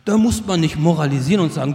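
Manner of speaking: usual speaking voice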